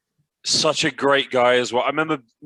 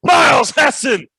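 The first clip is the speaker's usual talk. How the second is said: full-throated, stretched commentator shout; pumped-up commentary; 13 semitones higher, 8 dB louder, slower than usual. Acted. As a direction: roar the big dunk call, high and loud. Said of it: "whenever I get pumped up".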